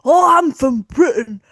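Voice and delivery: sneering British caricature voice. Retold or imitated. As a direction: parody of a jingoistic Brit boasting about pints. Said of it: "just fuck off".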